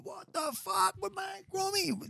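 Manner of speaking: high, drawn-out mock astonishment; retold amazed greeting. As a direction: imitate mates' disbelief, high and slow without shouting. Mates recognise him, retold.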